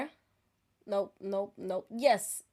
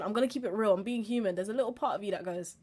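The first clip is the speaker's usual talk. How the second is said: ordinary talk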